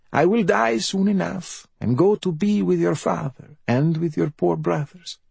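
high, self-pitying bedridden mother; high-pitched raspy invalid voice; rasping mother. A narrator's reading. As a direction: rasp it high, a mother playing at dying.

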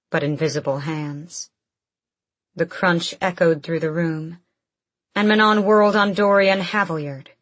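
ordinary delivery

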